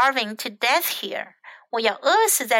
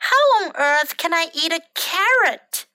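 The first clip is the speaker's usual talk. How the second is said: high, indignant storybook question; acted outrage from a hungry bird; exasperated shout; 6 semitones higher, 4 dB louder than usual. A narrator's reading. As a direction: protest in disbelief, pitched up. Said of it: "shouted Bird".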